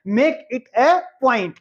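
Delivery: very slow and deliberate; very slow model sentence. Shown as a demonstration. Teacher slowly modelling the phrase for students. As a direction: stretch every word. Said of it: "क्या बोलेंगे?".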